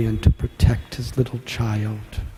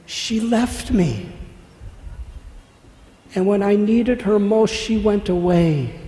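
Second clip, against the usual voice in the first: put-on high, loud, wounded child's complaint; tearful cry; 8 semitones higher, 7 dB louder than usual. A narrator's reading. Child Krishna protests that mother left him, crying out. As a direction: cry out the hurt, drawing out the first words. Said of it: "he started to cry".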